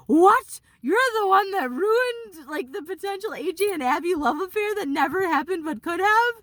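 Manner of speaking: high, loud retold outburst; shocked angry shriek at a friend; outraged disbelief. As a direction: hit it like the betrayal just landed. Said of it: "I was pissed at her".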